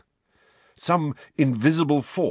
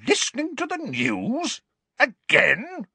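outraged disbelief. Acted. Outraged Dursleys repeat.

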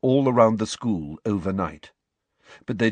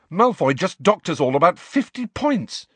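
higher, louder furious outburst; 10 semitones higher, 4 dB louder than usual. A narrator's reading. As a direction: spit it out in fury. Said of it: "said Harry furiously".

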